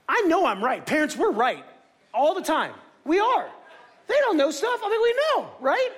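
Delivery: high, hammed-up parental certainty; insistent parent. Parent arguing they are right.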